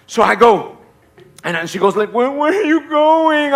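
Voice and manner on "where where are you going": high, long wailing question; whining wife impression. Wife calling after departing husband, mock whining. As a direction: stretch it into a plaintive wail.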